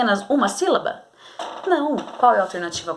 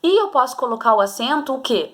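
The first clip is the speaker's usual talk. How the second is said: ordinary delivery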